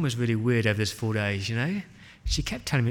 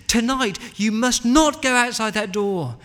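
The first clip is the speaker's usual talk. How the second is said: loud, high, urgent plea; tearful warning; 9 semitones higher, 6 dB louder, slower than usual. Acted. Tearful mum warning her child to stay indoors, urgent. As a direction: insist on it, voice raised, through mock tears. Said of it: "she had tears running down her face".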